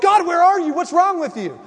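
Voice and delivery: high, loud accusing whine at God; mock indignant cry. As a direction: wail then scold, hammed up. People complaining to God.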